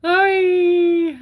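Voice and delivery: high, loud excited hello; excited greeting. Real, not acted. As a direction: burst out with a big hi.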